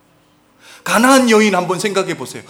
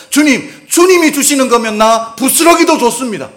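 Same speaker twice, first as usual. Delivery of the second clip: loud pleading; 5 semitones higher, 7 dB louder than usual. Acted. Woman pleading to Jesus.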